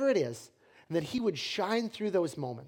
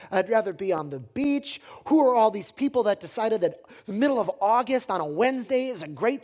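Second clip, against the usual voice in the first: higher-pitched exasperated rant; rising anger; 3 semitones higher, 4 dB louder than usual. Acted. Cook fuming at summer customers, rising anger. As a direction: vent mounting irritation, stressing each complaint. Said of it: "I start to feel that anger rise up".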